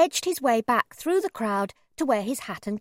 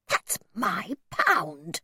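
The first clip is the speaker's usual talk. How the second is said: indignant hiss; 2 semitones lower, much slower than usual. A narrator's reading. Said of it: "my mother hissed".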